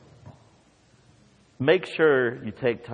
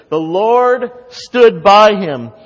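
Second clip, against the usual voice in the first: much louder, higher, slowed declaration; slow proclamation; 9 semitones higher, 11 dB louder, slower than usual. Acted. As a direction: proclaim it slowly, full voice. Preacher proclaiming key line.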